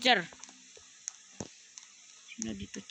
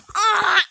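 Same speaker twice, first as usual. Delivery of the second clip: high, loud yelp of shock; real gamer scream; sharp scream; 11 semitones higher, 9 dB louder than usual. Real, not acted. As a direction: cry out suddenly.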